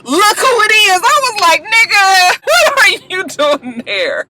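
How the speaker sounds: high, loud shocked exclaim. Real, not acted. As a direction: gasp out in disbelief. Shocked at Ray walking in.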